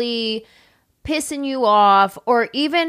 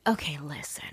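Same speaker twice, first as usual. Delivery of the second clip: hushed whisper; pretend whisper; 6 semitones lower, 13 dB quieter than usual. Pitching the director a secret plan, whispered. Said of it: "I would have been like".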